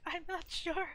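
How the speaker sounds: high, doubtful fretting; acted worrying. Artist acting out needing reassurance about the table, high worried fretting. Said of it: "I'm just like".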